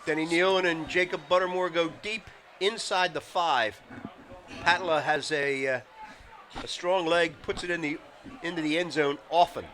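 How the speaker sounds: ordinary talk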